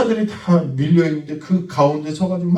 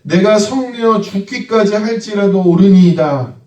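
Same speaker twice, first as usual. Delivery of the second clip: Jonah's reply; 7 semitones higher, 6 dB louder than usual. Acted. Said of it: "요나가 이렇게 대답합니다".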